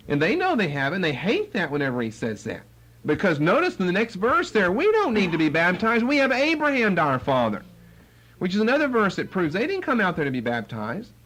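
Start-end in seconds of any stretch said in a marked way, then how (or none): 4.7-7.6 lightly voiced haughty retort, pitched higher; haughty Pharisees. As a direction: Pharisees brushing off John's baptism.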